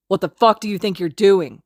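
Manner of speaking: loud furious snarl. Acted. Kacchan confronting Izuku over Haru, snarling loudly. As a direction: spit the question at him in rage. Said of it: "Kacchan snarls".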